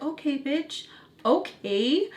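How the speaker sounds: a touch of sassy scorn at the cousin; sarcastic okay. Retold or imitated. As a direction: toss it off with mock disbelief. Reacting to cousin's betrayal.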